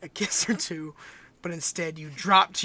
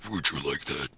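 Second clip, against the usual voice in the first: sultry low voice; 4 dB quieter than usual. Acted. Seductive question to lover.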